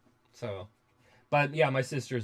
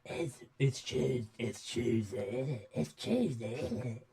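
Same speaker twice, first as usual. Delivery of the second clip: put-on grimy old geezer; 4 dB quieter than usual. Shown as a demonstration. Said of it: "grimy old man geezer voice".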